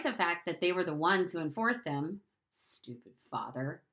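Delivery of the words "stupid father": low, soft and slow mutter; under his breath. Acted. Bruno mutters about his father under his breath. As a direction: mutter it so no one hears. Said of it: "he added under his breath".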